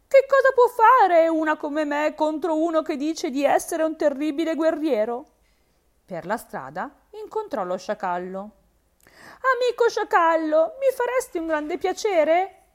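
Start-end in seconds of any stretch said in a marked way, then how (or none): 0.1-5.2 higher, louder frightened hare voice; hare's lament. A narrator's reading. The hare flees her den, lamenting to herself, higher. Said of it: "lamentandosi tra sé". 8.4-12.6 pleading hare. A narrator's reading.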